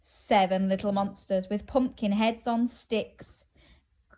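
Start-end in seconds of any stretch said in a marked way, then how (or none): 4.1-4.2 long drawn-out squeaky creak; creaking door. Acted. Secret door opening in a children's story, long creak. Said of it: "goes the secret door".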